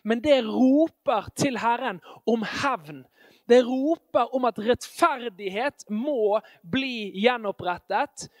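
ordinary talk, nothing marked

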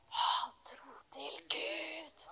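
slow, breathy whisper. Acted. Preacher urging the listener to have faith: slow whisper. Intimate exhortation after loud preaching.

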